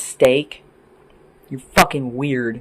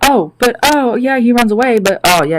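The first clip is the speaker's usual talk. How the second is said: mock objection; 7 semitones higher, 3 dB louder than usual. Acting out a sarcastic objection.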